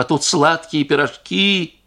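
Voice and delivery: slow, sneering; mock the sweet pies bitterly; bitter scorn. A narrator's reading. Wife sneering at the sweet pies while children starve.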